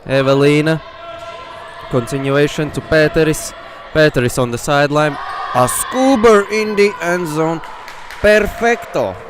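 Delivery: rising to a loud, high shout as the score lands; excited play-by-play. Real, not acted. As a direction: call the score at the top of your voice. Goal run in live commentary.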